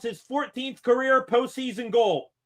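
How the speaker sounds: high broadcaster call, stress on career; play-by-play stat call. Acted. Streamer announcing Malkin's goal stat to viewers, broadcaster call.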